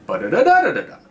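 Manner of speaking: "Ba-da-da-da-da-da-da" lifted like a question; question intonation. Shown as a demonstration. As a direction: hum out a question's melody on "Ba-da-da-da-da-da-da". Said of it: "You go up".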